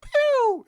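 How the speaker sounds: squeaky high pew sound; intro sound effect. Retold or imitated. Mimicking the intro sound effect, high and loud. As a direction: zap it out like a cartoon effect. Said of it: "voice saying".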